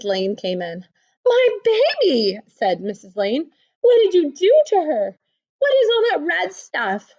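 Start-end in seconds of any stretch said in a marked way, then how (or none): 1.2-2.4 much higher, slow alarmed cry; shocked mother. Acted. Missus Lane seeing her baby covered in red, alarmed and much higher. 3.8-7.1 higher, louder upset questioning; alarmed demand. Acted. Missus Lane confronting Amelia about the red stuff, higher and louder.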